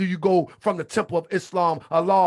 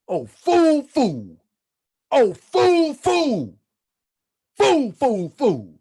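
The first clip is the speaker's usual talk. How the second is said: loud, rolling preacher's cadence with a chanted refrain; fiery preaching; 4 semitones higher, 5 dB louder than usual. Acted. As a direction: preach it hard to the congregation. Preaching, repeated chant of fool.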